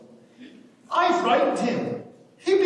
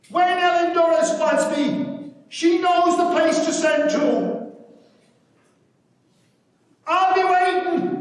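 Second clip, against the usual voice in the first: louder and higher, slow and firm; defiant; 6 semitones higher, 5 dB louder than usual. Acted. Matt to Ellen, parting after the quarrel, defiant and loud. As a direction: stake your ground proudly before leaving.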